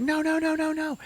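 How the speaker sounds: hammed-up terror; mock terrified cries. Rebecca trapped when the lights go out, high mock terror.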